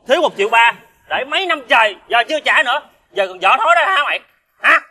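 high-pitched, indignant reproach; angry scolding. Acted. Friend listing past favours and an unpaid debt, angrily reproachful. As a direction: rebuke an ungrateful friend, pitch riding high.

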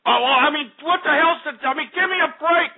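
high-pitched, sputtering complaint; exasperated protest. Real, not acted. Host fed up with the switchboard problem, high exasperated protest. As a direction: sputter it high, at your wits' end.

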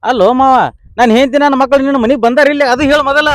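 loud, high demand at the brother-in-law; husband yelling at his wife's brother; angry shout. Acted. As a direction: shout it, a hothead defending himself.

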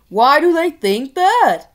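king pig demanding. Acted. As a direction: press him like a spoiled ruler.

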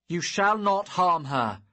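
loud, high defiance; defiant shout. Acted. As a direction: shout the refusal. Hero defies father.

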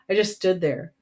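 plain reading voice

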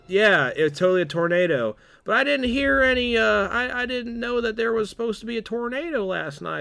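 high, put-on yokel farmer voice; farmer quote. Farmer telling the crew about a tornado, high put-on voice. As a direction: squeak it out like a nervous farmer. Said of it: "and it's like".

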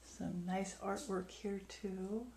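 ordinary talk

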